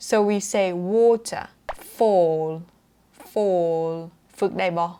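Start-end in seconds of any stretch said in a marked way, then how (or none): 0.9-3.9 WATER and FALL with a long aw; long rounded o. Shown as a demonstration. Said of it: "แล้วก็กลมนะ แล้วก็ยาวนะคะ". (water, fall เสียงออยาว ปากกลม)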